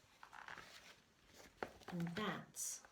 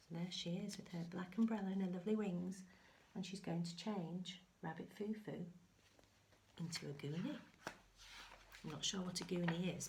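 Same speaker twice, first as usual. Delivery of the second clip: ordinary delivery, nothing marked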